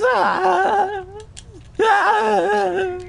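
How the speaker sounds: piercing high wailing "ah, ah"; imitated scream. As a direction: imitate the old man's screaming from the front door. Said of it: "All I hear is".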